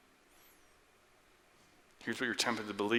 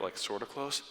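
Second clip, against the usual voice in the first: ordinary talk, nothing marked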